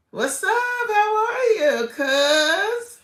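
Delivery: high, stretched-out sing-song hello to her cousin; gushing high greeting. Real, not acted. Greeting her cousin in the chat with a high, slow, gushing hello. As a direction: lift way up and slowly coo the greeting.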